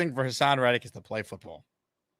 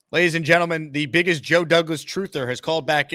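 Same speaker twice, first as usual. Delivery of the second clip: loud, high emcee intro; announcer voice; 4 semitones higher, 4 dB louder than usual. Acted. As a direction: announce it like a ring host. Introducing a caller.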